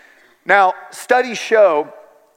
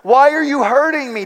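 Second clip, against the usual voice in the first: put-on high, louder desperate plea of a frightened little girl; voicing a terrified daughter's plea, higher and louder; pleading child; 7 semitones higher, 3 dB louder than usual. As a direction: beg like a scared child being held down. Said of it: "as she pleaded with me".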